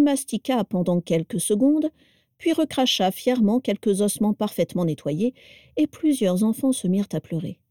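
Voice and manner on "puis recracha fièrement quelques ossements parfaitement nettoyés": usual speaking voice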